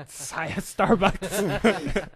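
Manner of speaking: loud, drawn-out snake-speech breaking into laughter; mock Parseltongue. Player joking in Parseltongue, slow, loud and laughing. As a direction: stretch it out slowly as joke snake language. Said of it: "Does he speak Parseltongue?".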